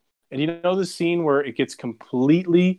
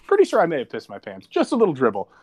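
ordinary delivery

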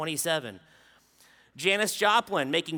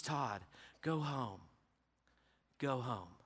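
soft, low, tired go home; weary dismissal; 7 semitones lower, 12 dB quieter, slower than usual. Retold or imitated. Dying neighbor quietly sending the preacher away, soft. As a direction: send him away quietly, worn out. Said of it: "He says".

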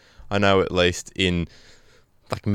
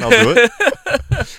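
very slow, high drawn-out boast; mock bravado; 13 semitones higher, 3 dB louder, much slower than usual. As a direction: stretch it out like a daredevil. Mock daring reply.

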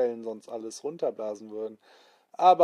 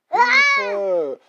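very high loud shriek; panicked cry for help; 10 dB louder than usual. Acted. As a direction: shriek in terror. Onkel Quatsch panics at monsters. (Onkel Quatsch kreischt vor Angst)

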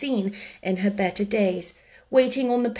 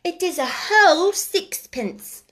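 put-on stoutly insisting boy; 9 semitones higher, 4 dB louder than usual. A narrator's reading. Small boy pressing sixpence on a girl, stoutly insistent. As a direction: insist like a determined little boy. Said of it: "he insisted stoutly".

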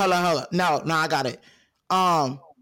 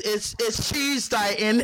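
put-on lightly done British accent, slow on Tuesday; a playful hint of a London accent. Asking a friend if she's that kind of British, in a mild British accent. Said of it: "this kind of British".

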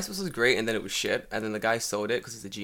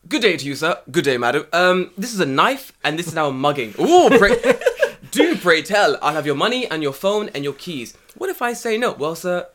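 louder mock posh mugging; mock posh Londoner, louder throughout; posh polite Londoners; 5 semitones higher, 8 dB louder than usual. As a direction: play an overly polite English mugging. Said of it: "Everyone's very polite, very well spoken".

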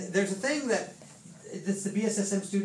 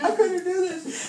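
high, drawn-out mock sobbing; mock tearful wail with laughter in it; imitated crying; 13 semitones higher, 4 dB louder, slower than usual. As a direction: squeal it slowly as if overcome with tears. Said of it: "she's all crying".

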